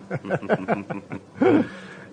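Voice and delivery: a short, bright laugh at his own quip; hearty laugh. Real, not acted. Policeman laughing at his own joke, bright laugh. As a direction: laugh rising as the joke lands.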